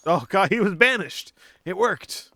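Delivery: excited high burst. Real, not acted. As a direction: blurt it out thrilled. Spell takes effect.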